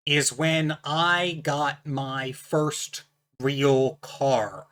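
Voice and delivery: ordinary talk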